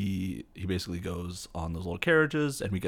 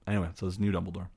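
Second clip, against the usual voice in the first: ordinary talk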